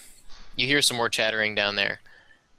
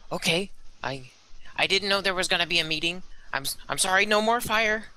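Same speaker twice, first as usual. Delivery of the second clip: grovel like a frightened goblin; meek creature backing down; cowering goblin; 9 semitones higher than usual. Acted.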